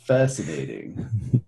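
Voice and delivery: low, slow Spock-like drawl; deep Spock voice. Pretend. Spock impression, Vulcan bit.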